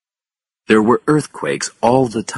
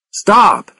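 loud, high-pitched shout; urgent shout to halt them; calling out; 6 dB louder than usual. A narrator's reading. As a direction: yell a warning after them. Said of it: "called Paul".

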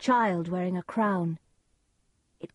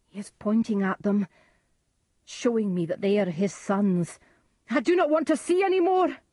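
louder, building to a high fast cry; rising horror; 3 semitones higher, 6 dB louder than usual. A narrator's reading. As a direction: panic rising to a shout. Macbeth horrified by vision.

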